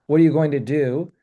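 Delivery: usual speaking voice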